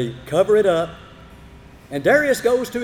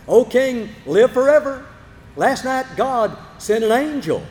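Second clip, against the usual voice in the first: shouting up; 6 semitones higher, 3 dB louder than usual. Acted. Daniel shouting up to king.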